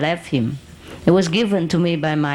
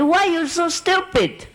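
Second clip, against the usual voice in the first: higher, slow mock scolding; retold angry scolding; 10 semitones higher than usual. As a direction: scold him, half-amused, drawn out. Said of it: "Oh, I was so mad at him".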